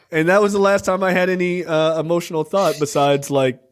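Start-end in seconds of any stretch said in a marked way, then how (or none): none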